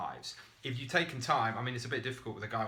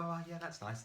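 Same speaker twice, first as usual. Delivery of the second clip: higher, softer mock-polite brush-off; 6 semitones higher, 7 dB quieter than usual. Distracted prospect brushing off an excited pitch, mock polite. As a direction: humor the eager pitch but don't care. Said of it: "nod politely and go".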